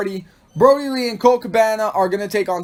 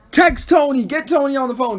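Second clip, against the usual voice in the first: mock demanding; 6 semitones higher than usual. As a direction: bark orders in mock panic. Imitating Britt demanding Tony.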